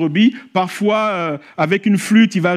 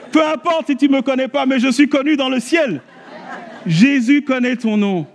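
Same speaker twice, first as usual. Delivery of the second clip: fervent preaching; 9 semitones higher than usual. Acted. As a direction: rouse the crowd and make them repeat it. Preaching at full force.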